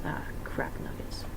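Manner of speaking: ordinary delivery, nothing marked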